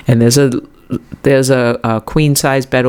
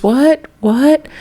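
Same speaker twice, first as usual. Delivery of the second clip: mock surprise; 12 semitones higher than usual. Mock double take.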